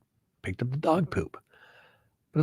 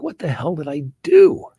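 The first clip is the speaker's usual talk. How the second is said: retold exasperation; 4 semitones higher, 4 dB louder than usual. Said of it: "I'm like".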